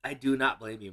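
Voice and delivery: ordinary delivery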